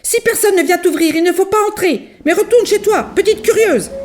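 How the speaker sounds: put-on a mother gently telling off a nosy girl; mama bear scolding. A narrator's reading. Mama bear lectures Goldilocks, mildly stern. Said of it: "la maman ours ajoute".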